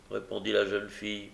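plain reading voice

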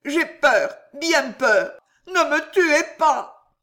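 high, trembling plea of terror; hiccuping with fear. A narrator's reading. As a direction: gasp it out, begging for life. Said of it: "hoqueta le vieillard".

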